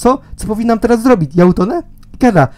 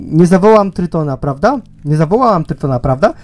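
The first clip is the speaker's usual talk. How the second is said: ordinary talk, nothing marked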